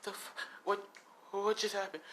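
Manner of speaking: high, soft, stammering disbelief; stunned son. Acted. Son left kneeling by his father, high stunned voice.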